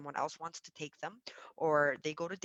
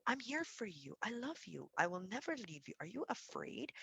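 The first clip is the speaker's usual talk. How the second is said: tender reassurance; 5 semitones higher, 7 dB quieter than usual. Shown as a demonstration. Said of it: "you'll say something like".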